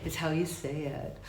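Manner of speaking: dropped low and soft; quiet, subdued take. Shown as a demonstration.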